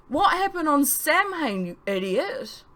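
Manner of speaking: high, slow furious demand; slow, high angry outburst. A narrator's reading. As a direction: rail at him, dragging it out. Charlie furious at narrator.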